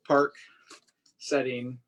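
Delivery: ordinary delivery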